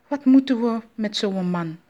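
loud, indignant objection; protesting. A narrator's reading. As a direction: object loudly to the whole idea. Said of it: "protesteerde Ma Akuba".

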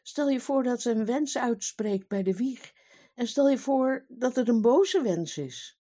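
fretting king afraid of a curse; worried king with a sighing tone. Acted. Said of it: "zuchtte de koning".

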